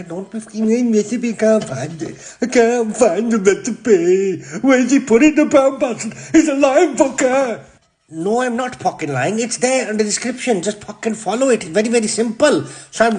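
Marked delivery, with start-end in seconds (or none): 0.0-7.6 a hint of a grumpy fan griping; grumbling viewer voice. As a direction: put on a whiny complaining viewer who laughs at the end. Imagined viewers moaning about recipe.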